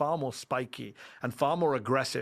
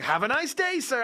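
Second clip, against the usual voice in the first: very high, bright mock farewell; chirpy shop assistant; 12 semitones higher, 4 dB louder than usual. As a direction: parody an overly friendly American clerk. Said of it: "they'd be like".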